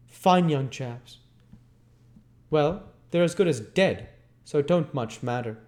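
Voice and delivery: rough, scornful man's voice; Sikes's voice. Acted. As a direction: sneer it like Bill Sikes. Sikes mocking Nancy.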